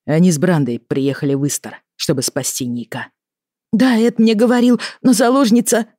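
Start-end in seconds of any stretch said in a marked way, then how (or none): 3.7-5.9 high, agitated and louder; upset daughter, voice raised high; distressed protest. Acted. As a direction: protest in alarm, then break off. Upset daughter protests hostage news.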